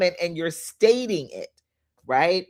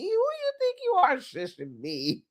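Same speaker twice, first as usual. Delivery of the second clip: sassy challenge; 9 semitones higher, 6 dB quieter than usual. Retold or imitated. Sassy retort to shushers.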